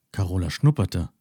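plain reading voice

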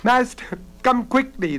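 high-pitched urgent call; shouting for help. A narrator's reading. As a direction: call out for the master in alarm. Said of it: "the girl shouted".

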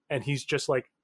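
ordinary delivery

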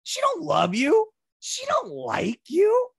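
mocking taunt. Mocking the Queen's indifference.

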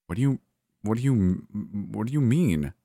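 halting, bewildered question; confused mutter. A narrator's reading. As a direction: stumble over the question in confusion. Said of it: "I muttered".

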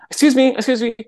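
high-pitched, mock indignant calling out; indignant woman. CEO storming up to the car booter, mock indignant. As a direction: imitate an irate woman demanding attention.

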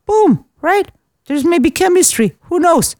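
upbeat retold sales pitch, lifted high; hopeful pitch. Woman coaxes her match to call, upbeat. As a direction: sell the possibility in a high, breezy voice.

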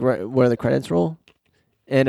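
usual speaking voice

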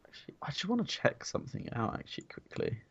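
muttered under breath, off to the side; quiet aside. Real, not acted. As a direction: half-whisper to himself. Checking something aside, off-mic.

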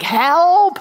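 desperate cry for help. Retold or imitated. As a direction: cry out like you're sinking. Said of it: "so I'm like".

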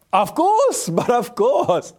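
squeaky high, delighted acceptance; eager yes. Retold or imitated. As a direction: jump at the offer, overjoyed. Eager yes to jet ski invite.

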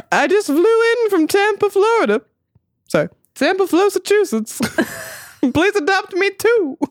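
high-pitched comic stork voice; old borscht belt comic. Acted. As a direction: ham it up as a chatty stork. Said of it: "Why am I doing this voice?".

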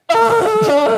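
a high, loud, overwhelmed "Oh,"; like you could cry from the compliment. Retold or imitated. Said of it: "like, in my head".

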